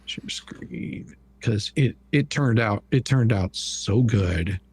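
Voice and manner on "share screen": whispering to self while clicking; breathy aside to nobody; whispered aside. Real, not acted. Muttering to self while sharing.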